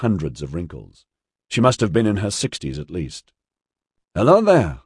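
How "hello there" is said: high, bright-spirited hello, louder; cheerful. A narrator's reading. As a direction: greet cheerily, pitched well up. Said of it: "she said cheerfully to Orion".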